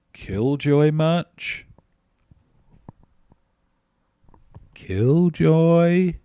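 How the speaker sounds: loud, slow, drawn-out retort; hammed-up outrage at a killjoy; host firing back at a killjoy neighbor, loud and slow; mock indignant.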